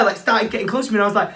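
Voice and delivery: normal talking voice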